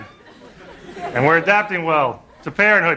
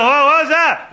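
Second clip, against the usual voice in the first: higher, jittery wife startled awake; wife startled at night, higher mock alarm; mock scared wife; 9 semitones higher, 3 dB louder than usual. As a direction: play the wife hearing a noise. Wife voiced, woken by noise.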